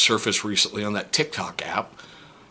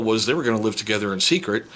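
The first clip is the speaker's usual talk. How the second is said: ordinary talk, nothing marked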